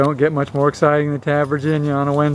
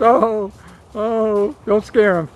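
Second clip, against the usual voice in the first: startled reaction; 6 semitones higher, slower than usual. Real, not acted. Dogs lunge, owner reacts and cautions.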